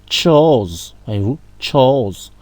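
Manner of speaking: chose said slowly with a TCH at the start; drawn-out chose starting with tch; slow, clear tch in chose; tch sound. Shown as a demonstration. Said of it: "on va dire ch".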